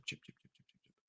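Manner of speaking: breathy whispered chant of "Chip"; hushed, urgent whisper chant; whispered pleading. Real, not acted. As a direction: whisper the name over and over, willing it to come.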